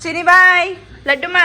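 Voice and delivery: high, loud send-off call; calling out goodbye. Real, not acted. Woman calls goodbye to a little one, calling out.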